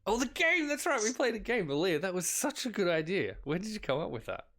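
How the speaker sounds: high, bright burst of delight; excited Nessie. Acted. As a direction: gush with sudden enthusiasm.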